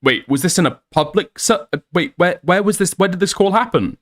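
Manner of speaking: high, baffled questions, playing dumb; mock confused Aiden. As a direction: feign total confusion about the call. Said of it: "being like".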